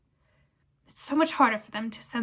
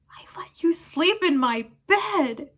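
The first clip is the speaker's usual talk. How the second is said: slow, high, breathy wheedle; wheedling whisper; 7 semitones higher, 5 dB louder, slower than usual. Acted. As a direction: coax him in a whisper. Pettigrew whines to Ron.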